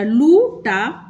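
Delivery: लू टा drawn out slowly; sounding out लू टा slowly; long oo stretched. Shown as a demonstration. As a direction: hold the ऊ in लू long. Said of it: "खींच के बोलेंगे".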